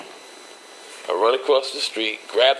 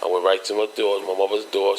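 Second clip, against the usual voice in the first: ordinary talk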